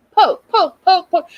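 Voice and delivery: high, loud little jabs of voice; playful high poke poke sounds; mock needle pokes. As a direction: squeak it out like a needle poking.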